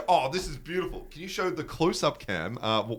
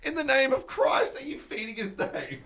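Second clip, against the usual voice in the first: higher, laughing through the words; cracking up while quoting; 11 semitones higher than usual. Retold or imitated. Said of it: "Dave's like".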